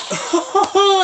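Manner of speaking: a high burst of real laughter. Real, not acted. Laughing as the guitar shot comes to mind, real laugh. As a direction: laugh out at the memory.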